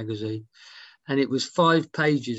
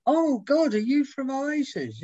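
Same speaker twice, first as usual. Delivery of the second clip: starstruck fan; 12 semitones higher, slower than usual. Retold or imitated. Fan realises a band member is in the reading room, squealing high. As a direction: squeal it like you just spotted a pop star. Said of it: "They go".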